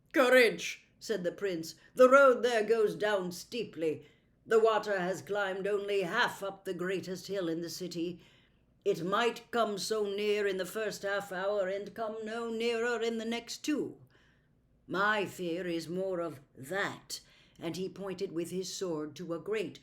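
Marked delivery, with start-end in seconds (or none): 0.1-0.8 loud noble call; rally them with authority; commanding prince. Acted. Prince rousing the party, loud commanding voice. 1.9-17.2 loud noble call; rally them with authority; commanding prince. Acted. Prince rousing the party, loud commanding voice.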